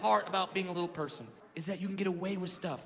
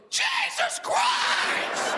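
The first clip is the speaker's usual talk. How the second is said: very high, drawn-out shrieked Jesus Christ; startled scream; 4 dB louder, much slower than usual. Retold or imitated. Woman terrified on seeing him walk on stage, shrieking. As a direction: scream it like you've seen a monster. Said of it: "just goes".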